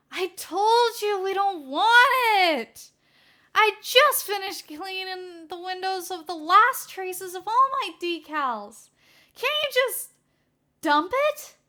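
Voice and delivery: high, loud, slow exasperated wail; a fed-up assistant at the end of her rope. Acted. Burnin complaining to Naomasa, high and loud.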